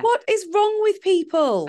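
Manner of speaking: real exasperated outrage on the host's behalf; indignant outburst. Real, not acted. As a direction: vent genuine indignation at the blokes. Listener outraged at the story.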